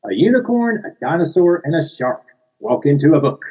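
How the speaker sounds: bright read-aloud, high on unicorn; reading to children. Shown as a demonstration. Author reads picture book aloud to children, bright storytime voice. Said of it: "here we go".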